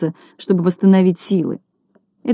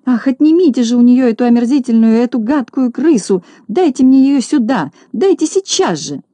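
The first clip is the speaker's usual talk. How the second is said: high-pitched, horrified demand; frantic lady's outcry, acted; crying out; 6 semitones higher, 3 dB louder than usual. A narrator's reading. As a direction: cry out in disgust, high and insistent. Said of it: "закричала".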